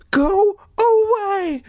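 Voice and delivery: high, slow trembling cry. Acted. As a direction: cry it out, voice shaking. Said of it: "Dory cried in a trembling voice".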